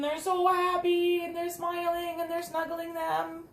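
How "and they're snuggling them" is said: usual speaking voice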